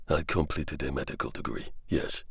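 calm French doctor answering the soldier. Acted. Xavier answers.

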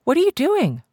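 high, loud demanding cry; sharp demand. Acted. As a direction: snap the question at him. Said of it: "she demanded".

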